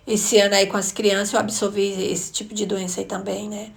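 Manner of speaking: usual speaking voice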